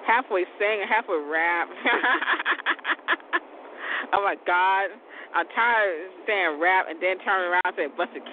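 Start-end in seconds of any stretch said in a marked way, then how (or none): 1.7-3.4 high laugh. Real, not acted. Laughs at his rhyme joke.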